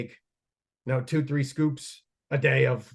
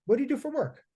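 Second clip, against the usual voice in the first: lightly imitated doctor, pitched much higher; retold doctor; 12 semitones higher, faster than usual. Doctor asking a patient about work, lightly mimicked. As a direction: put on a hint of a high-voiced doctor. Retelling the doctor's question to him.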